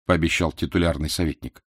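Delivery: usual speaking voice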